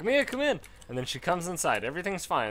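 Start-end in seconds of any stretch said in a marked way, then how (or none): 0.0-0.6 high sing-song call to the dog. Retold or imitated. Owner calling the dog back inside, high call. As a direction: call her in, sweetly high. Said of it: "'Cause then you're like".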